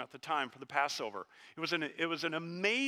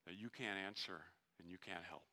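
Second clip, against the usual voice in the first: tender sermon hush; 6 semitones lower, 9 dB quieter than usual. Acted. Preacher telling the congregation of Jesus' tears, hushed and tender. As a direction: draw them close and speak gently. Tender quiet passage on weeping.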